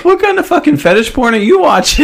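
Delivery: high, squeaky, laughing question; incredulous squeal breaking into laughter; laughing disbelief. Real, not acted. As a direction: ask it through helpless laughter. Laughing disbelief at nipple belt question.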